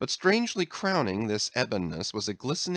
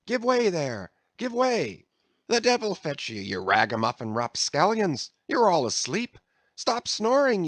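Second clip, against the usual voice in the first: driving mate; 6 semitones higher than usual. A narrator's reading. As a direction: whip the crew into pulling harder. Stubb driving crew.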